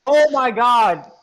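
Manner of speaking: loud, high, drawn-out burst of shock; real shock. Real, not acted. Spotting the card price jump, loud slow shocked outburst. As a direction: blurt it out, stunned.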